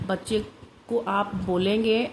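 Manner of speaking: usual speaking voice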